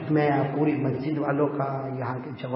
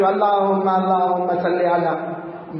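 slow, drawn-out Arabic supplication; loud, stretched prayer intonation; imitated imam; 3 semitones higher, 4 dB louder, much slower than usual. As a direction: mimic an imam starting the dua. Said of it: "پھر امام صاحب سے".

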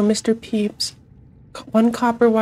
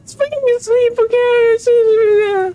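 high squeaky voice; 14 semitones higher than usual. Acted. Teasing reply, voice pitched up.

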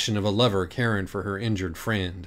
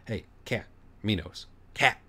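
soft, urgent hush; urgent whisper; 5 dB quieter than usual. A narrator's reading. Dirk whispering urgently to the cat, soft. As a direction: whisper it so no one hears. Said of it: "whispered with urgency".

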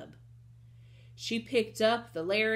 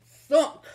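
loud, punched thud word; sudden booming impact; crash sound effect; 6 semitones higher, 13 dB louder than usual. Acted. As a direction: drop it like a huge body landing.

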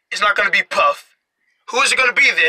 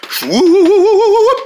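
high, loud wavering whoop; long rising cartoon swoop noise; 11 semitones higher, 6 dB louder than usual. Imitated whooshing action noise.

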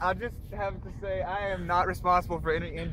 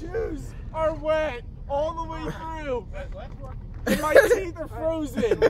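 whiny complaint; 7 semitones higher, 5 dB louder, slower than usual. Real, not acted. Cold after sledding.

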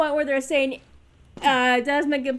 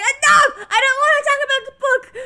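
high, loud, quick mock outburst; imitated anger; 10 semitones higher, 6 dB louder than usual. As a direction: imitate someone losing patience fast. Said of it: "getting angry".

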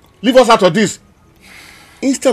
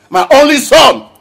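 higher, louder repeat of my only son; anguished outburst; 6 semitones higher, 5 dB louder than usual. Acted. Father grieving his son, anguished raised voice. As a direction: cry it out in anguish.

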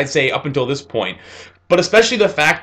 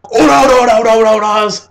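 much higher, louder rapid battle shouts; battle cry; 7 semitones higher, 6 dB louder, faster than usual. Retold or imitated. Star Platinum pummelling the ghoul horde, shouting battle cries. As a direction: bellow the punches out like a fighting spirit.

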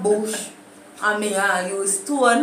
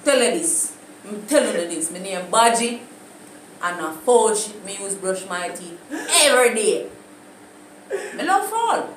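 louder, bragging Jamaican patois with laughs; hammed-up patois boasting; patois brag; 4 dB louder than usual. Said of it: "Anyways, my time".

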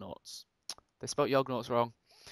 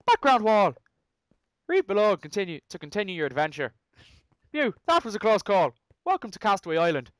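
higher, louder mock-Irish reading; attempted Irish accent; 4 semitones higher, 5 dB louder than usual. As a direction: announce it in a shaky-grasp Irish accent. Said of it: "I can't read in an Irish accent".

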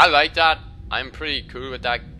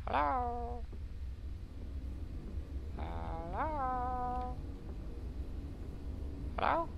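higher, soft squeaky hello; 11 semitones higher, 10 dB quieter, much slower than usual. Pretend. A gamer calling into the dark in a tiny high voice.

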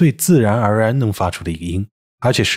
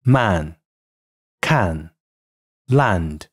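British man, can, land with plain open a; British English demo of man, can, land; British pronunciation; 4 semitones lower, 3 dB quieter, much slower than usual. Shown as a demonstration. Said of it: "而它们在英音中的发音为".

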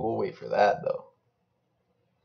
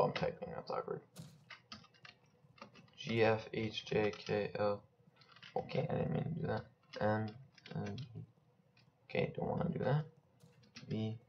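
1 muttering while typing; 11 dB quieter than usual. Real, not acted. Gamer fumbling keys, muttering to himself quietly. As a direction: mumble under your breath at the keyboard.

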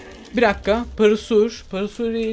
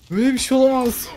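laughing disbelief; faster than usual. Real, not acted. Disbelief at the game event.

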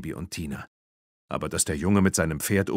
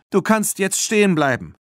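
high, raised call across distance; 11 semitones higher, 5 dB louder than usual. A narrator's reading. Bibi to the fleeing Erik, calling out. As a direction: call after someone running away. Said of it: "rief sie ihm zu".

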